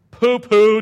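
loud, high mocking pooh-pooh; grinchy scoff. Jonah mocking the repentant people, loud scoffing. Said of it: "Jonah was grinchingly humming".

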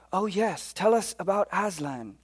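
excited British plea for the story; British accent. Acted. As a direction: chime in eagerly with a British accent. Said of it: "in my very best British accent".

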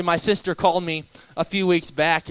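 ordinary delivery

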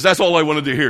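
loud, high mock yell; imitated enraged driver. Enraged driver accepting a false apology, loud mock anger. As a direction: bark it as a satisfied but irate driver. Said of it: "he was just so enraged".

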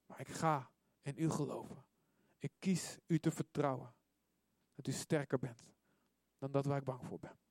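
soft, subdued confession of faith; quiet prayer. Acted. Leading the congregation to choose trust, quiet prayer. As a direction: pray it softly, phrase by phrase.

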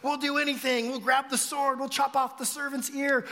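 loud, high mock boast; eager bravado. Disciples pledging to fight for Jesus, loud bravado. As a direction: imitate overeager followers talking big.

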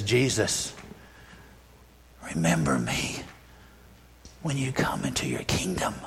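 dying thief turning to Jesus, soft and humble; quiet plea. Retold or imitated.

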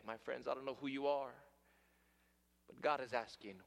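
usual speaking voice

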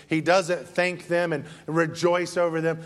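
ordinary talk

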